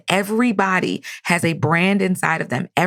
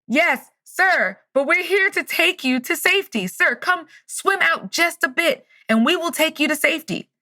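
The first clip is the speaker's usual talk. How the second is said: high, stretched-out insistent call; 9 semitones higher than usual. Retold or imitated. Rescue crew urges man to swim out, calling. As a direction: imitate hailing someone far away.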